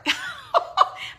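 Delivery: real high laugh through tears, embarrassed; teary laugh. Real, not acted. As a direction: laugh off the tears as they come. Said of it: "I wasn't gonna cry".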